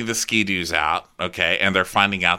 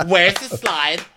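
high, quicker whiny demand; mock demand; 9 semitones higher, 4 dB louder, slower than usual. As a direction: imitate a guest demanding "Where's the slide" in one go. First take of acted demand.

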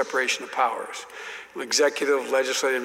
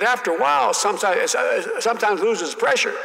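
higher, louder mock Southern senator; folksy senator explaining; 7 semitones higher, 3 dB louder than usual. Senator lecturing the young colleague on oil wells, hammed-up. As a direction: patiently explain like an old senator. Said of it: "He said".

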